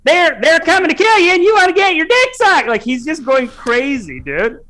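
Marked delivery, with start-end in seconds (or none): 0.0-2.7 higher, louder barking drill instructor; drill sergeant yell. Retold or imitated. Imitating the Full Metal Jacket soldier, yelling at recruits. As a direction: bark it like a drill sergeant in boot camp. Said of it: "Full Metal Jacket where he's like".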